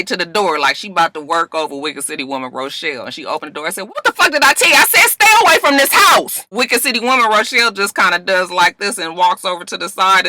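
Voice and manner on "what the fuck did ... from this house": high, loud furious demand; angry confrontation. Retold or imitated. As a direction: tear into her at the door. Tasha confronting at the door.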